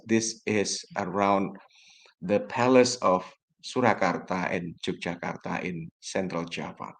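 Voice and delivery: usual speaking voice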